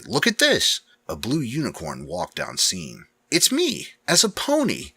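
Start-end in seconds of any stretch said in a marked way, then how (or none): 0.1-0.8 high, loud cry of surprise; surprised. Acted. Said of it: "David's surprised voice". 3.3-4.9 high, loud and thrilled; excited. Acted. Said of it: "the excited unicorn in David's voice".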